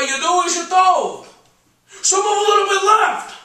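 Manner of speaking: theatrical patter climbing to high commands; grand, blustering artist; brash painter, bossy. Acted. Zero bosses the interviewer as model.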